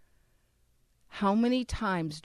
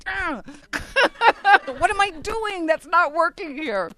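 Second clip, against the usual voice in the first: talking through laughter, higher and louder; laughing; 10 semitones higher, 8 dB louder than usual. Real, not acted. Speaker laughing at her failed witnessing. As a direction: laugh at her own evangelism flop.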